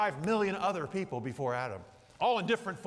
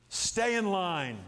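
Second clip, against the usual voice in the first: slow emphatic stay in line; much slower than usual. Shown as a demonstration. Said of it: "It means stay in the line".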